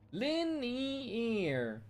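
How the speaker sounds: soft, drawn-out "Linear" with the R dropped; mock British accent. Streamer mocking British English, mock British accent. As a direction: mimic the British clip softly. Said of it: "easy to make fun of".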